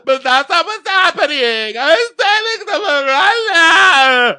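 very high, loud mock sobbing, words stretched out; hammed-up crying meltdown at top pitch; hysterical wailing. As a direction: wail it out like a melodramatic crybaby. Mimicking sobbing reality contestant.

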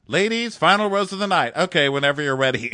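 high, loud, rushed mock announcement; hurried host voice. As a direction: rattle it off impatiently to the ladies. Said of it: "He's like".

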